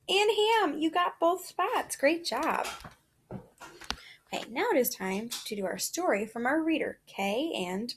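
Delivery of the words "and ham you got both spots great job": high, bright-voiced cheering of a right answer; excited praise. Real, not acted. Teacher to a student who got both commas, excited.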